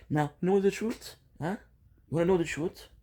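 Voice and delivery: high, rising challenge to the listener; challenging. Real, not acted. Vlogger confronting critics over filming on the balcony, challenging.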